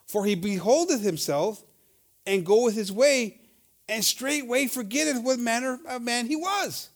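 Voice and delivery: slow, deliberate Bible reading; solemn King James reading; scripture reading. Acted. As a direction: read it slowly, like holy text. Reads verse twenty-four.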